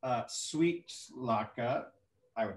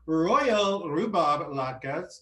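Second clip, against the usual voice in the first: grand announcement; 4 semitones higher, 6 dB louder, much slower than usual. Pretend. Grand announcing of dish name.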